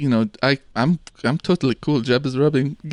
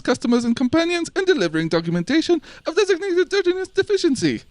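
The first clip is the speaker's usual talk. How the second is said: tongue-twister rattle; 7 semitones higher than usual. Acted. Alliterative paperwork recital, rising.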